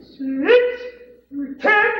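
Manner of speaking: loud, very high squawked bid; strange inflection. Shown as a demonstration. What not to do when bidding, strange squealed inflection. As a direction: leap up into a silly squeal. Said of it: "put strange inflections in your voice".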